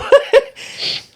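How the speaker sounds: unvoiced giggle, very high; high breathy laugh. Real, not acted. Laughing while telling the story to the host, breathy giggle. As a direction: let a breathy giggle escape. Laugh after her denial.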